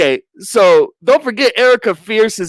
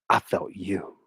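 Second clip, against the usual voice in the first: very low, soft mock-romantic line; low, quiet soap-opera drama; hushed confession; 9 semitones lower, 11 dB quieter than usual. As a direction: act out an intimate admission. Acts intimate admission.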